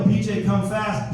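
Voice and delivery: usual speaking voice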